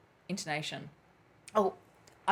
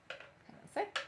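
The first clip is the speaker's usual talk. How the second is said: whispered aside; 11 dB quieter than usual. Real, not acted. Aside while reading questions.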